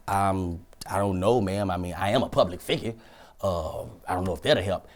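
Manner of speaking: low, soft, modest admission; humble retold mumble. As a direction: keep it down, reluctant to boast. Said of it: "I said it low".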